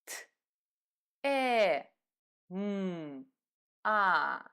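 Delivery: "Т, Э, М, А" as separate sounds; sounding out letters. Shown as a demonstration. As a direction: give "Т, Э, М, А" one by one with pauses. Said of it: "name the sounds that these letters".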